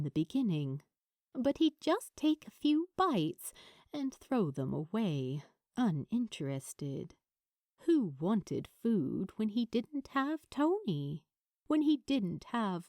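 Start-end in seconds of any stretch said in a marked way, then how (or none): none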